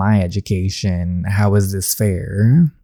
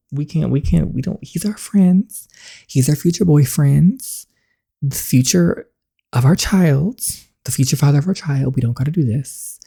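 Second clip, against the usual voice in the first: flustered gushing; 5 semitones higher than usual. Real, not acted. Flustered over her crush.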